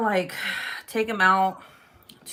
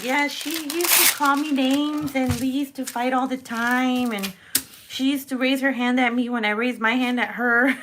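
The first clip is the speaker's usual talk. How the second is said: lightly voiced mom, higher and laughing; a hint of a laughing mother; 5 semitones higher than usual. To her viewers, playing her mom complaining, a touch higher. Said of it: "And she'd be like".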